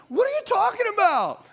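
high, indignant retold protest; incredulous retort. Husband repeats his protest to wife, high incredulous voice. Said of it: "I looked at her, I go".

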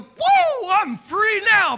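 sudden high, loud whoop of relief; mock joyous yelp, stretched out slowly; mock elated cry. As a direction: ham up a churchgoer set free. Mock freed churchgoer.

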